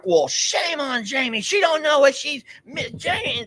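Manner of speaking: high mock-scolding fan voice. Husband imitating fans scolding his wife, high mock scold. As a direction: wag a finger at Jamie in a high voice. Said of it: "they're like".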